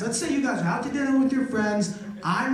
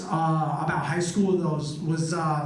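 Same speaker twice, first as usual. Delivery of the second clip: ordinary delivery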